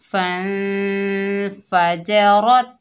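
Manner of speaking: drawn-out, chanted tajweed reading; slow Quran recitation. Shown as a demonstration. Teacher demonstrating recitation to students, slow and chanted. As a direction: intone each syllable slowly like a Qari. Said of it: "रॉ को पुर पढ़ेंगे".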